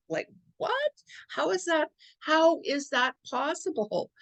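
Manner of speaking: high, incredulous pitch leap on what; retold stunned disbelief at the news; how is that even possible, high and amazed; shocked disbelief. Retelling shock at a security call.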